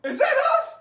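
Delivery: panicked buddy. Retold or imitated. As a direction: squeak it out like a startled friend. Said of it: "he goes".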